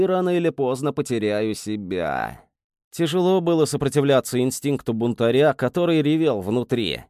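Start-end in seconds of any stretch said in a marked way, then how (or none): none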